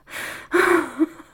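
high breathy giggle; a nervous excited giggle; giggling. Acted. Laugh in scene.

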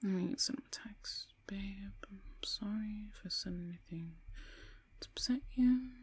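soft, halting, half-whispered; murmured texting. Acted. Woman typing an apology to boyfriend, murmured. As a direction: say it under your breath as you type. Composing apology text alone.

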